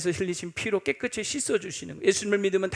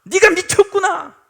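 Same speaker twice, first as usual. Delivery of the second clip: Festus shouting, much higher and louder; angry shout; 8 semitones higher, 11 dB louder than usual. Acted. Roman governor yelling at Paul, furious shout. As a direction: bellow at Paul that he's mad. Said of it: "갑자기 소리를 지르면서".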